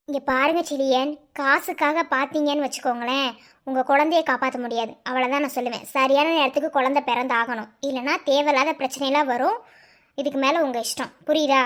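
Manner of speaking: low, firm doctor voice; a doctor laying down the facts; stern doctor. Acted. Doctor warns husband about cost. (கண்டிப்பான doctor குரல்)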